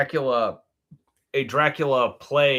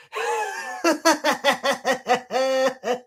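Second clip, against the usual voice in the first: high, shrieking madman cackle; maniacal laugh; 12 semitones higher than usual. Pretend. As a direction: cackle like a crazed servant. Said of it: "I had the laugh".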